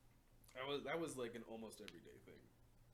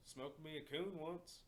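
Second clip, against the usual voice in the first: slow, drawn-out, higher-pitched imitation; imitated Arkansas man; 4 semitones higher, slower than usual. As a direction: stretch it out as the odd Arkansas guy. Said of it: "he talk like this".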